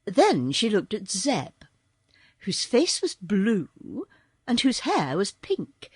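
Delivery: ordinary delivery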